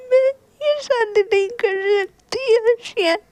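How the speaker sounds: high, halting sobbing; tearful confession. A narrator's reading. Bride confessing she loves someone else, sobbing. Weeping confession of loving another.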